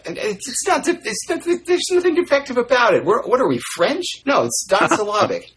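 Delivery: high-pitched mock outrage; indignant outrage. Real, not acted. Colleague protesting indignantly in a high voice. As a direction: squeak out your protest, offended. Indignant defence of two syllables.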